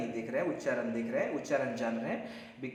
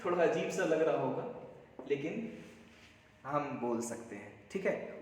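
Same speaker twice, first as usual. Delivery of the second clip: ordinary talk, nothing marked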